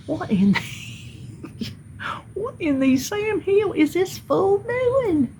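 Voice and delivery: high, slow, laughing what-in-the-world; laughing disbelief. Retold or imitated. Watching the neighbor beat a tree, laughing disbelief. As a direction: marvel at the fool through a laugh. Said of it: "I'm like".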